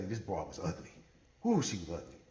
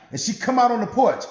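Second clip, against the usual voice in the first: ordinary delivery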